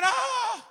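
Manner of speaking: high, loud comic yell; retold scream. Rafter flushed down the rapid, high retold scream. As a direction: re-enact screaming out of your mind. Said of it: "And I'm screaming".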